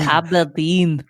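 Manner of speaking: slow, dragged Scottish Aberdeen, kæblədin; overdone Scottish Aberdeen; slow Scottish accent. Pretend. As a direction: exaggerate the Scottish, swallowing letters. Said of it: "you're leaving out letters".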